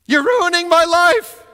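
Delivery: put-on high, loud, slow teen wail; melodramatic teenager. Teen whose phone was taken, wailing high and loud. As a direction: overplay the wronged teenager.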